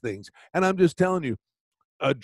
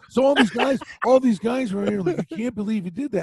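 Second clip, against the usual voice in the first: excited; 7 semitones higher, 4 dB louder than usual. Real, not acted. Excited retelling, raised pitch.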